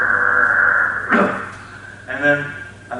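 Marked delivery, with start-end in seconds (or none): 0.1-1.4 low, breathy, straining grunt; effort grunt. Retold or imitated. Chiropractor voices adjusting her atlas, straining grunt. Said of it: "just went".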